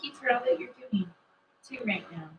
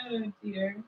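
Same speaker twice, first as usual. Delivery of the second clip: normal talking voice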